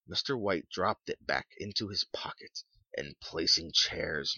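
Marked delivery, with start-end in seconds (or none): none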